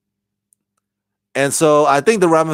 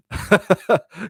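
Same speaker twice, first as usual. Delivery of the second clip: high chuckle; short amused cackle; laugh; 7 semitones higher than usual. Real, not acted. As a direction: chuckle before carrying on. Amused by the villains' fate.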